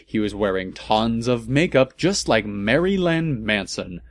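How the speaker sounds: ordinary delivery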